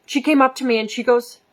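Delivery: usual speaking voice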